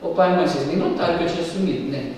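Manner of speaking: a quiet, slightly cheeky reproach to the parents; a lightly voiced boy Jesus chiding his parents; mild reproach. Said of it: "podia falar assim".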